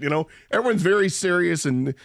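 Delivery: normal talking voice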